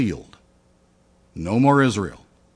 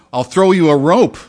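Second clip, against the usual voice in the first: calling down; 5 semitones higher, 8 dB louder than usual. Acted. Helicopter crew yells down.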